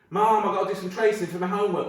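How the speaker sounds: high-pitched schoolkid plea; kid asking mum. Acted. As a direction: play the eager child asking mum.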